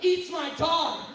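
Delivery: ordinary talk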